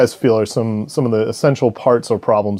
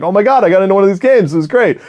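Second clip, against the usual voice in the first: excited; 9 semitones higher, 3 dB louder than usual. Retold or imitated. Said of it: "playing with Danny was like".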